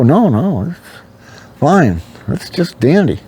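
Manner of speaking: sarcastic it's-just-dandy. Retold or imitated.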